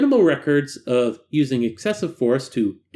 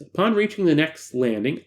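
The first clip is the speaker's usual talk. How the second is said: usual speaking voice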